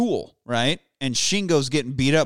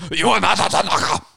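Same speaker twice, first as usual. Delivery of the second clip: angry quote; 3 semitones higher, faster than usual. Retold or imitated. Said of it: "He's so angry".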